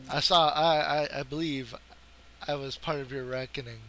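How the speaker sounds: usual speaking voice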